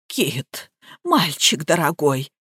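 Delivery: soft, low plaintive appeal; whimpering. A narrator's reading. Nika appeals to dear Kit, whimpering. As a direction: whine to him under your voice. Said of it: "захныкала Ника".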